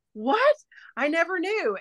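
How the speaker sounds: very high, stunned "What?"; shocked. Retold or imitated. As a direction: act out the coworker's amazement, pitch way up. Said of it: "And she was like".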